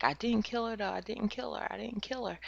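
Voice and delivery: high, fading desperate denial; hysterical denial. Retold or imitated. Brooke insisting to her parents, a high fading plea. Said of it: "she's like".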